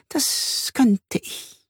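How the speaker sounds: slow, high, whispery drawl; wispy spider voice. Acted. Spider weighs the detectives' request, wispy and coy. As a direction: draw it out high and airy.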